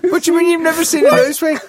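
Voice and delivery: high, fast disbelief; can't believe what you heard; incredulous. Real, not acted. Disbelief at never seen one.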